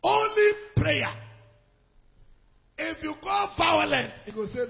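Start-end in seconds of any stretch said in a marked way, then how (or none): 0.0-1.2 slow, much higher and louder; climactic shout. Real, not acted. Preacher's climactic answer. 2.8-4.1 warning shout. Real, not acted. Preacher warning wives.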